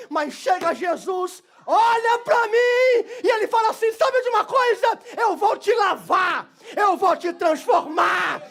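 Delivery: loud, high, slow preaching with a cry in it; full-force preaching. Acted. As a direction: shout the promise of grace to the church. Preaching climax, shouting.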